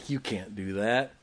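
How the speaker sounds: low, softly muttered mock objection; imitated scoffer. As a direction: quote the doubters under your breath. Scorners quoted, first low.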